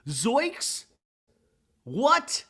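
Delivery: high, rising cartoon shock; hammed-up startled questions; zoinks surprise. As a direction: squeak out mock astonishment, pitch shooting up. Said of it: "has a zoinks face".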